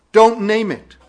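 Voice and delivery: loud, high emphatic order; forceful command. Acted. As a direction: proclaim it as a stern command. Preacher quoting Paul's command.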